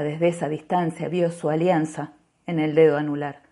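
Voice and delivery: usual speaking voice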